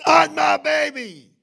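high, loud, drawn-out plea to God; retold plea at a shout; desperate cry. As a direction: cry it out slowly at full force. Retold desperate plea, repeated louder.

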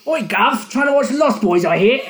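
high, loud mock-British lad; badly faked British accent, called out loud. Mocking the actor's slipped accent, badly faked British. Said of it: "I can't do a British accent".

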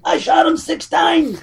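drawn-out, high hammed-up cry; mock dramatic movie line, stretched out slow; movie quote impression. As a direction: imitate an overwrought film character. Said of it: "That's like someone else fucking saying".

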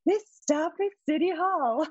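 high, sweet announcement voice; soft subway announcer. Shown as a demonstration. Showing the hosts the subway voice, soft, high announcer. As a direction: soften it into a polite station announcement. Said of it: "You have to be softer".

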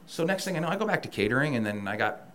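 ordinary talk, nothing marked

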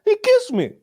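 very high mock outraged squeal; shocked fighter. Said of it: "the guy's like".

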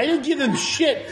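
high, laughing indignation; comic exasperated denial. Real, not acted. Exasperated reply to absurd question.

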